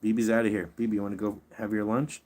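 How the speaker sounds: ordinary delivery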